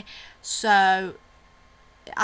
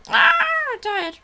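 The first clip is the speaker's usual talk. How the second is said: high whiny voice for the dog; 9 dB louder than usual. Pretend. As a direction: speak for the dog, high and whimpering. Voicing the sleepy dog.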